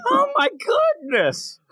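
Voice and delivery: high, hammed-up gasp of scandal; mock shock. Play-acted shock at the sighting.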